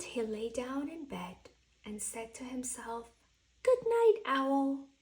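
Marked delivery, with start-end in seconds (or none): 3.6-4.9 lightly voiced Owl, higher and louder; Owl's goodnight. Owl says goodnight to himself, a cheery call.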